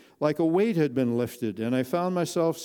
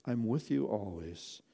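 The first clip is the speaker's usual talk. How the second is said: soft, slow and tender; whispered; 2 semitones lower, 8 dB quieter than usual. Acted. Jesus softly promising the narrator, tender whisper. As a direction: breathe it close and gentle. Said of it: "he whispered to me".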